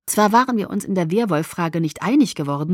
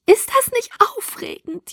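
much higher, slow, gushing squeal; squealing with excitement; 3 dB louder than usual. A narrator's reading. Alice freut sich über die Entführung, juchzend hoch. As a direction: squeal it with glee. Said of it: "juchzte sie begeistert".

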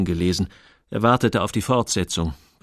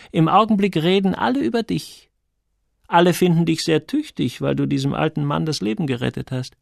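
slightly lifted character voice, passing on praise; a hint of an approving confidante; lightly voiced Sonja, friendly; 7 semitones higher than usual. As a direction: lightly play a friendly woman reporting the gossip.

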